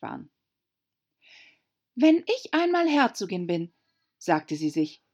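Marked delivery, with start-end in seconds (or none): 1.9-3.7 put-on a higher young girl's voice; Alice, girlish. A narrator's reading. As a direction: muse aloud as a little girl. Alice denkt laut. (Alice spricht zu sich selbst, mädchenhaft höher)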